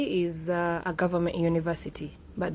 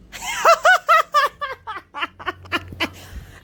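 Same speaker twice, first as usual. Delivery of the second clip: real laugh; 7 dB louder than usual. Real, not acted. Laughs at own pronunciation.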